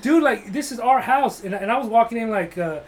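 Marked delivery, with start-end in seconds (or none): 0.0-1.4 higher, louder cocky retort; mock cocky boast. New guy tells nervous boss this is our house, mock cocky. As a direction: lay loud claim to the place. Retold cocky claim to coworkers.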